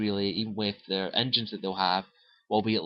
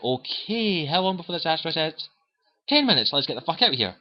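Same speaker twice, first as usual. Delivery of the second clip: hurried; 6 semitones higher than usual. Retold or imitated. Shepard rushing to escape.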